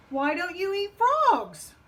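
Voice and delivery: puzzled frog. Acted. Frog asks Wolf the reason, puzzled. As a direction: stretch it out, climbing to the end.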